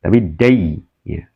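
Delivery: "dai" with a clear closing i; short diphthong. Shown as a demonstration. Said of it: "Pakai i ya".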